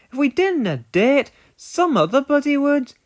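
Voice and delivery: easygoing Scottish shrug in the voice; Scottish twin engine. Acted. Scottish twin shrugging to James, Scottish accent. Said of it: "the Scottish twins".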